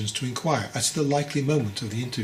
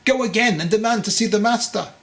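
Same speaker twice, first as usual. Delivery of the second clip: high, loud pushy mother's voice; demanding mother; insistent mother; 8 semitones higher, 5 dB louder than usual. A narrator's reading. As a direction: press him hard as the mother. Mother urges the father.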